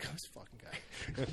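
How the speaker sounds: whispered under the breath; exasperated whisper aside. Retold or imitated. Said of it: "And I'm like".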